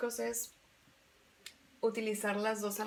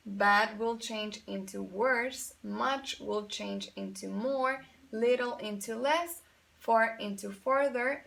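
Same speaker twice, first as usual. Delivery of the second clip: each form "Bad", "worse", "more", "less" said slowly and deliberately; slow model list; 3 dB louder, much slower than usual. Shown as a demonstration. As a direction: read the irregular pairs slowly for students to repeat. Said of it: "I have the adjective good".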